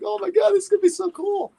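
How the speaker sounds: put-on high, gushing kid excitement; excited. Young guitarist reacting to Shorty's invitation, high and thrilled.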